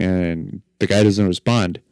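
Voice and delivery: ordinary delivery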